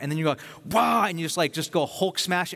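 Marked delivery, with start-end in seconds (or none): 0.7-1.1 loud, high mock roar; explosive angry outburst. Acting out someone exploding to make a point, mock roar. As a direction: burst out like a sudden Hulk explosion. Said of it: "do you explode in anger to make a point".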